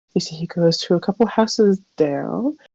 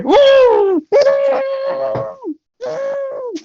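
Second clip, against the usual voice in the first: high, wild hooting cries; frantic high-pitched woos; aggressive hoots; much slower than usual. Acted. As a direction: whoop it out like a caged animal. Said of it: "Really aggressive".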